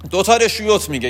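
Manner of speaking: normal talking voice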